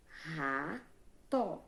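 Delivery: "Rato" slowly as ra... to; split into syllables. Shown as a demonstration. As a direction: separate "Rato" into two long beats, ra and to. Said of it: "é uma palavra que só tem duas".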